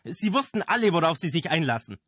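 high, loud reproach; scolding. Acted. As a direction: scold them loudly. Angry director scolds cast.

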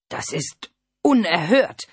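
slow, outraged count gasping out his fury; panting with rage. A narrator's reading. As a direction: spit it out breathless with anger. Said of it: "keuchte vor Wut".

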